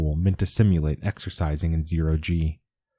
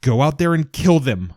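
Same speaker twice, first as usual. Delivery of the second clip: yell; 6 semitones higher, 6 dB louder than usual. Acted. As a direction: scream the order at him in horror. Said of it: "I yell".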